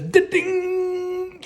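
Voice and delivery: high ringing da-ding like a struck pole; mock clang of stick on pole; metal clang. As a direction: ring it out like metal.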